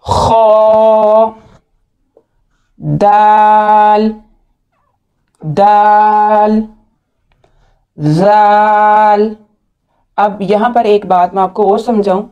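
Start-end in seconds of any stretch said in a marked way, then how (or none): 0.0-1.3 खो with rounded mouth, thick and heavy; heavy rounded kho. Shown as a demonstration. Teacher models a full खो for students. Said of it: "मुंह गोल करके ... मोटा पढ़ेंगे". 2.8-9.4 दाल and जाल held very slow and clear; drawn-out letters. Shown as a demonstration. Teacher models दाल and जाल for repetition. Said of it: "teacher models each letter".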